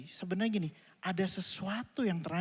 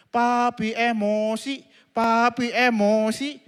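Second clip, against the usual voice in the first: put-on slow sing-song child taunt; slow childish chant; child teasing; 5 semitones higher, 9 dB louder, much slower than usual. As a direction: drag it out like a kid teasing dad. Child teasing angry father.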